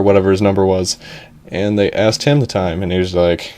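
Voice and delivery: ordinary delivery, nothing marked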